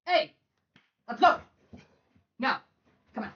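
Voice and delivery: high, firm mom voice. Real, not acted. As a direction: order the dog out like a mom. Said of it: "My mom voice is about to come out".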